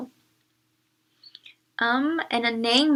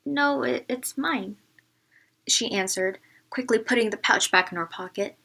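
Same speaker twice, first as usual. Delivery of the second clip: put-on reading a storybook to children; story narration; 4 dB louder than usual. A narrator's reading. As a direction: read it like a classroom storyteller. Teacher reads picture book aloud.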